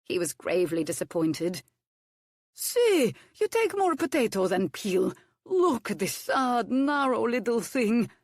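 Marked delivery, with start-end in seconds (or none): 2.5-8.1 put-on high, slow exasperated accented cook; mock dismay at a skinny peel; scolding cook. A narrator's reading. Hercules scolding Violet's thin peel, high and slow.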